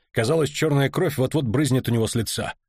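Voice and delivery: ordinary delivery, nothing marked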